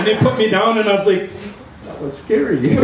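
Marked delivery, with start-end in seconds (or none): 1.9-2.8 words half-laughed; laughing. Real, not acted. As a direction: laugh through the line. Laughing after son lifts him.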